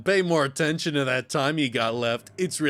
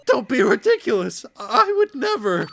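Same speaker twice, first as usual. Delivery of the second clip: high, loud character voice; high-pitched, offended denial; indignant protest; 8 semitones higher, 6 dB louder than usual. Acted.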